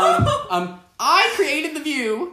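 slow, high, loud host impression; grand mock declaration, drawn out; Barbara Walters. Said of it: "Barbara Walters".

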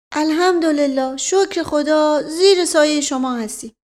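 put-on high, stammering old man; flustered old servant. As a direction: stumble over his words, scared.

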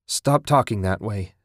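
ordinary delivery

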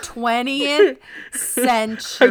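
twentieth century loud, slow and over-enunciated; slow, exaggerated diction on twentieth century; mock pronunciation lesson. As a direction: spell out twentieth century syllable by syllable, teasingly. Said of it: "And it's pronounced".

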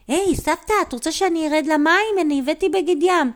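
high, quick offer; eager child. Acted. Child offering grandma to go into the water, eager.

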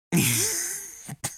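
shrill cackle breaking out mid-rant; high squealing laugh. Real, not acted. Laughing at her own roast of a commenter, squealing.

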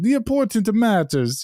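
mock Zeus in a thick, bad Greek accent. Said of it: "I'm gonna do a bad Greek accent".

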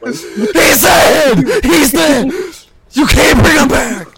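high, loud, hammed-up wail; mock anguished shout. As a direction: scream it like melodrama.

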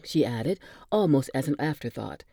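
ordinary delivery

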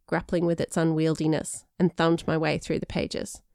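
plain reading voice

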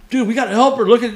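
loud, high retold cry for help; rally your friends, urgently; urgent plea. Urging buddies to save a drowning girl, urgent shout.

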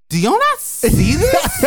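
high, slow, stunned "do you not see this"; can nobody else see it, drawn out and high; incredulous disbelief. Retold or imitated. To her friends at Macy's, stunned high disbelief. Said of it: "I was just looking at them like".

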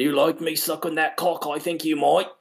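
high cartoon British voice; Popeye-like woman. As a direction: play the ridiculous-voiced lover, amused. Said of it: "she talked like that, like fucking Popeye".

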